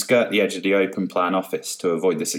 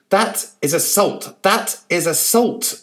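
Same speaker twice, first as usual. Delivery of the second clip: high slow outraged yell; 11 semitones higher, 4 dB louder, slower than usual. A narrator's reading. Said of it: "the journalist yelled".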